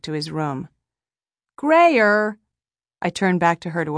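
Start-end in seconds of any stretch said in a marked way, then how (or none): 1.6-2.4 louder, higher rebuking shout of GRAYER; scolding call. A narrator's reading. Calling after rude boy running away.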